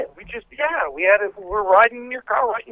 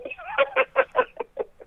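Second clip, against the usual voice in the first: high laugh; 4 dB quieter than usual. Real, not acted. Denies having been in jail.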